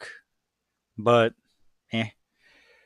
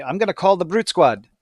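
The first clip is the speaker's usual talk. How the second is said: higher, louder mock movie character; terrible movie impression; 6 semitones higher, 5 dB louder than usual. Podcaster quoting a movie line, in a bad impression. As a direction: put on a bad movie voice, pitched up and loud. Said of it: "that was a terrible impression".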